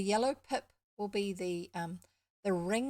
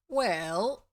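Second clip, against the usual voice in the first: self-important; 9 dB louder than usual. A narrator's reading. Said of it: "replied Joe with an important air".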